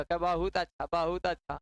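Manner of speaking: mock polite praise. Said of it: "वो हंसत रहेगा".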